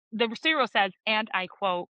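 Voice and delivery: usual speaking voice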